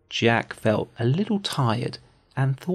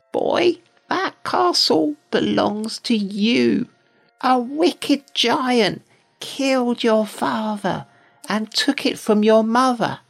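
put-on old fairy woman; 10 semitones higher than usual. Old fairy woman warns Jack.

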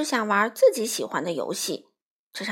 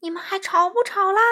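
high, loud menacing question; spooky shouted challenge, pitched high; wild ghostly cry; 9 semitones higher, 5 dB louder than usual. A narrator's reading. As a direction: demand an answer in a howling ghost voice. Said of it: "幽灵狂叫着发问".

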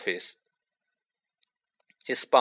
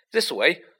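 higher, louder call; shout; 6 semitones higher, 4 dB louder than usual. A narrator's reading. Harry calls his friends up the stairs, shouting. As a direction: shout the way ahead. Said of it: "he shouted".

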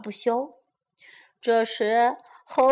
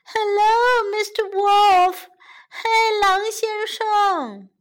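high, drawn-out timid greeting; frightened rabbit; 7 semitones higher than usual. A narrator's reading. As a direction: greet the wolf in a small high scared voice. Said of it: "小兔子吓得直发抖".

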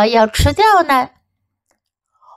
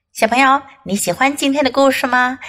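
normal talking voice

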